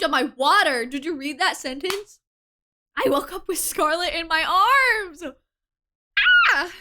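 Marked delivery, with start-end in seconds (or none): 3.0-6.7 higher, louder, half crying out; giddy fangirl cry; excited squeal. Real, not acted. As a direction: gush it out in delight. Excited reaction to the scene.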